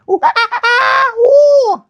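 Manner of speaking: piercing high dolphin squeals, shouted; dolphin squeaks. Pretend. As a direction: squeal like a dolphin at the top of your range. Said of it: "let's talk to 'em together".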